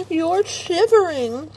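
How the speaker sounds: high, slow cooing voice. Real, not acted. Owner cooing to her shivering dog, high and slow. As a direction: coo it slowly to the trembling dog.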